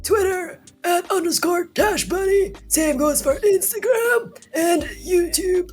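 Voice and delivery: put-on high strained old man outro; croaky grandpa signing off. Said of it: "can I do the close of my grandpa voice?".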